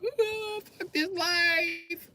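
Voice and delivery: put-on high, slow, whining kid voice; crying Russian kid. Russian kid crying about his life, high and slow. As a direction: wail it like a miserable kid. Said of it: "Russian kids spend a lot of time crying".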